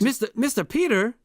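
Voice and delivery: high, loud put-on accent; Dora. Housekeeper calling to Peter in role-play, put-on accent. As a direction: call out in a hammed-up character accent. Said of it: "I'm doing an accent".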